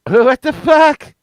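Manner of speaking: high, loud laughing jeer; mocking yell. Boys jeering at the naked man, loud high laughter. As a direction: laugh at him while you yell. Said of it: "we were like".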